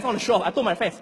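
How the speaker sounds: usual speaking voice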